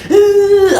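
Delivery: a high, loud disgusted ugh; mock angry grunt. Imitating the manager's loud high grunt in her face. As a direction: blast out his in-your-face grunt.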